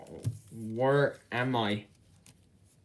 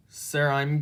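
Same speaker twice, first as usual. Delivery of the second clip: ordinary talk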